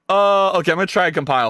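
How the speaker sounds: normal talking voice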